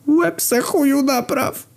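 very high, drawn-out mock crying; tearful wail. A narrator's reading. Doctor answering the patient's insult, high tearful wail. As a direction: wail it in a squeaky, weepy pitch. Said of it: "zapłakał lekarz".

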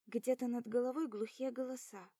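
ordinary delivery

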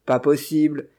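exclaiming. A narrator's reading. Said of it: "s'écria le vieillard".

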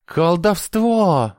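high, long-drawn wail of accusation; howling. A narrator's reading. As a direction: howl it out, stretching the word. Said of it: "взвыл инквизитор". (взвыв, протяжно)

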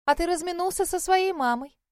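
high, loud gushing hostess; chatty older lady. Acted. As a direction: gush like a chatty neighbour.

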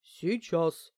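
curt, heavy grumbled reply; grumpy grunt. A narrator's reading. Housekeeper sent off to cook, grumbling. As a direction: grunt it out reluctantly. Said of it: "буркнула домработница".

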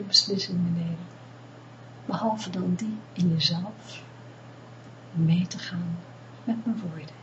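calm them down gently, close in; guided meditation. Acted. Leads a meditation. (rustige meditatiestem)

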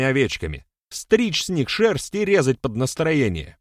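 high, loud rhetorical challenge; heated accusation. A narrator's reading. Public accusation, rhetorical questions. (обличительно, на повышенных тонах)

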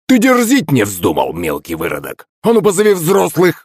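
put-on indignant old man, pitch jumping up; high, heated outburst; boiling over. A narrator's reading. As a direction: flare up at a cheeky kid. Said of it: "мгновенно закипел старикан".

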